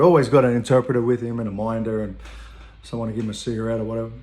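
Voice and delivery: ordinary talk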